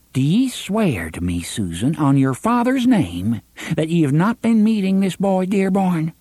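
a hint of a stern old aunt. Aunt demands Susan swear.

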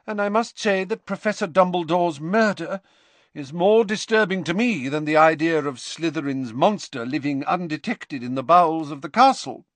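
stern headmistress figure. Acted. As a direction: play the troubled senior professor.

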